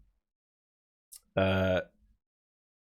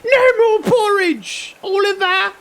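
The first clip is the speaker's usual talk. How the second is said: Cockney orphanage keeper; 4 dB louder than usual. Retold or imitated. Streamer plays an orphanage keeper scolding Oliver, Cockney. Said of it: "orphanage keeper from the eighteen hundreds".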